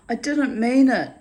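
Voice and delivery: loud, high mock Romanian man making excuses; Romanian accent. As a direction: imitate the neighbour's Romanian accent, pleading. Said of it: "he said that in a Romanian accent".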